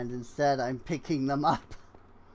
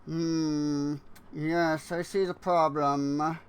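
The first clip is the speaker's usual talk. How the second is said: a hint of a smug cartoon villain musing; Fat Cat villain voice. Gamer musing at his build in a mild cartoon villain voice. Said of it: "old cartoon villain voice".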